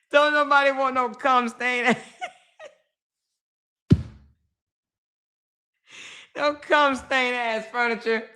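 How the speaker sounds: high, laughing while talking; can't stop cracking up; laughing through it. Real, not acted.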